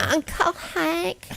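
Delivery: high, drawn-out cartoon character voice; Louis from King of the Hill. Host imagines waking up as a cartoon character, high slow impression. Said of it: "talking like Louis on, on, uh, King of the Hill".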